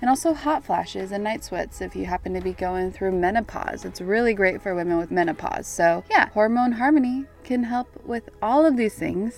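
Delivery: loud, upbeat sponsor read. Acted. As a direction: sell it like a radio ad. Host reads sponsor ad.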